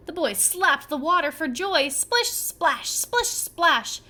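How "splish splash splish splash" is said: playful splashy chant; splashing sounds. A narrator's reading. Boy slapping water.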